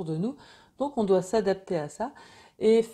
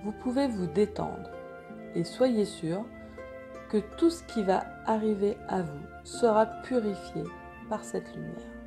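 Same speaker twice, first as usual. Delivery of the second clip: guided meditation; 5 dB quieter than usual. Acted. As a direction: guide them gently into calm.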